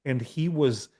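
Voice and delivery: ordinary talk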